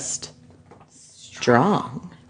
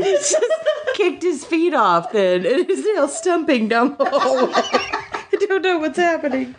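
high, giggly struggle to speak; baffled, laughing too hard to read; real laughing; 10 semitones higher than usual. Real, not acted. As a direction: lose composure while retelling. Reader cracks up at story.

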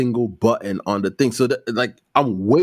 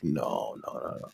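hushed low no; soft denial; 12 semitones lower, 13 dB quieter than usual. Retold or imitated. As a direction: mumble a quick denial. Denying quietly.

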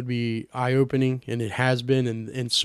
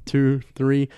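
ordinary talk, nothing marked

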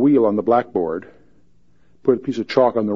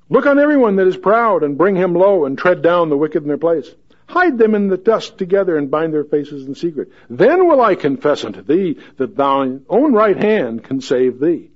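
booming God; 5 semitones higher, 4 dB louder than usual. Acted. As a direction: thunder God's challenge at Job. Key verse read forcefully.

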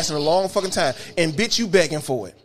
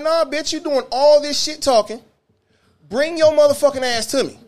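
raised-pitch, fired-up challenge to a troublemaker; confrontational challenge; 7 semitones higher, 3 dB louder than usual. Retold or imitated. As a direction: call him out, pitched up and forceful. Acted challenge to rowdy passenger.

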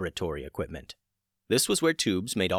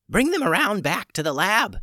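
yelling; 11 semitones higher, 4 dB louder than usual. A narrator's reading. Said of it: "she yelled back".

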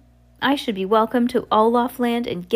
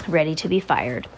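ordinary delivery

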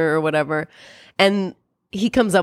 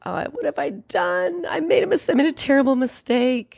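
mock despair, pitched much higher; a hammed-up cry of regret pitched way up; 8 semitones higher than usual. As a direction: wail it like a melodramatic fool. Alvy regrets breakup.

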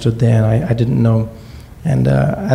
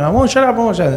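defiant mom; 10 semitones higher, faster than usual. Retold or imitated. Mom defies husband.

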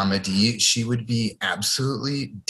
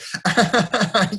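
a short, higher nervous laugh, showing his habit; awkward nervous laugh; 8 semitones higher than usual. Shown as a demonstration. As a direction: laugh like you are uncomfortable. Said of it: "I tend to laugh when I'm uncomfortable".

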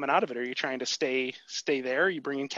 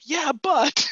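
much higher and louder, mock frustrated whine; inner whine; 13 semitones higher, 9 dB louder than usual. Host voicing a dieter's heart on a plateau, high loud whine. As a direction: squeak it out like a stubborn heart. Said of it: "your heart is still like".

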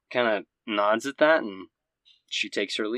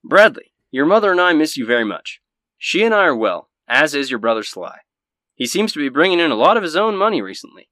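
loud news-anchor delivery of a father's letter; Anderson Cooper; 3 semitones higher, 8 dB louder than usual. Acted. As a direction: read it out like a TV anchorman, projected. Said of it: "let me do my best Anderson Cooper impersonation".

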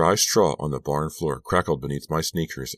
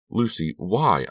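higher, pained question; choked-out plea; 6 semitones higher, 4 dB louder, slower than usual. A narrator's reading. Girl confronts Lucy with mixed emotions, anguished plea. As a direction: plead for a reason, mildly anguished. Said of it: "I managed to choke out".

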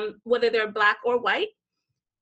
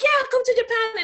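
high, loud sales-pitch cheer; imitating over-eager friendliness; mock friendly invitation; 12 semitones higher, 5 dB louder than usual. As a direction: beckon with exaggerated welcome. Mimics Japan's friendly invitation.

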